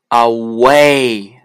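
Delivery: loud "away" drawn out on the w glide; careful word demo. Shown as a demonstration. Teacher showing w inside a word, loud slow demonstration. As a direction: stretch "away", sounding w as oo. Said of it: "如果W在单词的中间呢".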